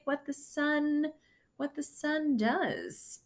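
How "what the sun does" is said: ordinary delivery, nothing marked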